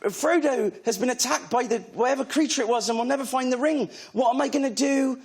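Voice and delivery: high, hammed-up audition speech; Vicky Pollard. Actor re-enacting his Frodo audition, high imitated character voice. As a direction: imitate a comic character pleading in a put-on accent. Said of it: "it sounded like Vicky Pollard".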